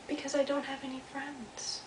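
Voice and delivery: high, soft, faraway echo; distant echo. Acted. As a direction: repeat it faintly, as from across a pond. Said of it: "and then he heard".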